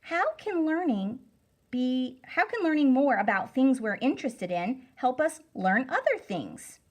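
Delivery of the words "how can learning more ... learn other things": normal talking voice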